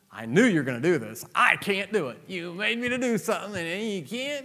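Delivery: high, pouty mock complaint of a reluctant prophet; whiny Jonah. Jonah complaining to God, high whiny mock voice. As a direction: whine like a sulking prophet. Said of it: "just like Jonah".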